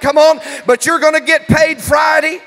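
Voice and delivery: loud, driving revival preacher; shouted, rallying sermon delivery; full-force preaching. Real, not acted. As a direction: fire up the congregation at full volume. Preacher rallies the congregation.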